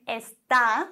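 "Está" with the last syllable A stressed. Shown as a demonstration. Teacher showing está stressed on the A. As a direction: push the stress onto the A of "Está". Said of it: "Betonung auf dem A".

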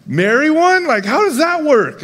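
mock shock, voice jumping way up; incredulous. Incredulous retold reaction to prophet.

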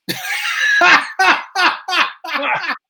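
laughing. Real, not acted. Listener floored by the explosives punchline, high peals of laughter. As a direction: burst out in high, helpless laughter.

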